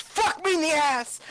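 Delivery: high-pitched yelled swearing; hammed-up sweary outburst; mock yell. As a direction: yell a curse in comic exasperation. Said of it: "he just yells".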